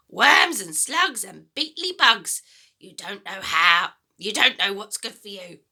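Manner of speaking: put-on a greedy, gloating old woman's voice; wicked old grandma. Grandma taunting George about eating slugs, gloating. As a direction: savour every disgusting word.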